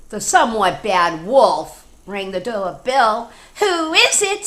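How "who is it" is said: very high, loud pig question. Acted. The pigs ask who is at the door, very high. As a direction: squeak it out as the little pigs. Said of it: "said the pigs".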